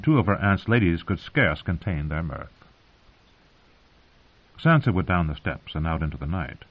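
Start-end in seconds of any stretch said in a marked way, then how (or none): none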